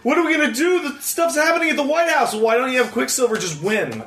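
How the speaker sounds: sarcastic heroes wondering why not use Quicksilver; mock panic. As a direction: send up the heroes' fretting. Mocking the film's characters.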